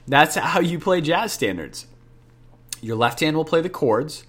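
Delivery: normal talking voice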